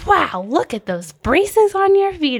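high, loud, drawn-out wow of wonder; gushing schoolkid amazement; amazed kids. Retold or imitated. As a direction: imitate awestruck classmates, stretched out and high. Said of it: "Everyone was just so amazed".

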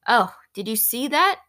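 mildly worried at being caught; anxious. A narrator's reading. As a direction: ask with a touch of alarm. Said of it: "he looked at her anxiously".

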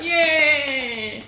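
mock cheer. Imitating an award show cheer.

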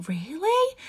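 high-pitched surprised question; doubtful childminder. Retold or imitated. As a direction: imitate her disbelief, voice lifted high. Childminder's disbelief, imitated.

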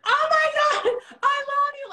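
high, loud squealing fan with a laugh; crying fan. Retold or imitated. Retelling a fan who shouted at her, a high loud squeal. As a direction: imitate a gushing fan squealing. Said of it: "Like, crying".

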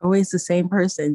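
ordinary talk

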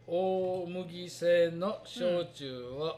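slow, deliberate label reading; reading aloud. Shown as a demonstration. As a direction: read the label out word by word. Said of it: "ちょっと読んでみようか". (ゆっくり読み上げる)

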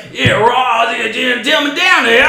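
high, garbled Aussie drinker impression; hammed-up, barely intelligible Australian accent; thick Australian accent. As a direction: slur it like a morning-drinking Australian. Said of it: "most spot on accent I've ever heard".

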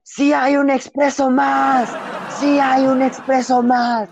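high, slow drawn-out yell across the station; shouting attendant. Retold or imitated. Station attendant yells to the boys running away, drawn-out shout. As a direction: call out far and long like a station worker. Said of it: "el señor grita".

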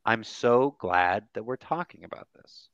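ordinary talk

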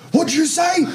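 high, sharp mock-menacing stepdad; threatening stepdad. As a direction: imitate the stepdad daring him to repeat it. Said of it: "he's like".

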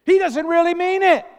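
put-on high, loud childish whine; Jimmy whines to parent that Tommy's not sincere; whiny kid complaint.

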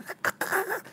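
squealing high-pitched laugh; high laughing fit. Real, not acted. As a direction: break into helpless laughter. (fou rire aigu)

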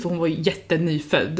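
ordinary talk

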